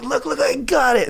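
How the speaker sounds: high eager "look, I got it"; excited claim. Retold or imitated. Artist showing off his design, excited. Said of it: "then be like".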